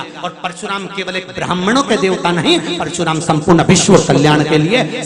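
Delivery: loud, climbing sermon at full force, laughing in places; fiery preaching. Acted.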